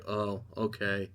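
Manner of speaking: soft, slow, let-down shrug; mock meek acceptance, quiet and slow; deflated oh okay. Butcher rejected by Ryan at the party, soft deflated shrug. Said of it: "And Butcher's like".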